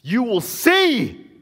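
high, slow, loud prophetic warning; solemn warning. Acted. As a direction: drag it out like a verdict. Jesus' warning acted slowly.

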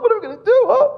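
high, loud mock panic; hammed-up frantic king; the preacher mocking a panicking king, high and loud; king flipping out. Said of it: "he flips out".

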